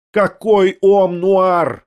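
put-on old lady shouts at the French maid, slow and loud; shouted question. A narrator's reading. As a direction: shout it out slowly, demanding. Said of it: "прокричала Татьяна Павловна".

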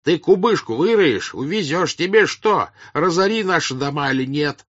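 loud, high-pitched accusations; heated high-voiced tirade; angry shouting. A narrator's reading. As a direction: shout reproaches at the village elder. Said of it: "кричал на него Карп".

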